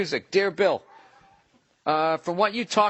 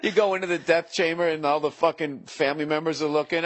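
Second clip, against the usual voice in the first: ordinary talk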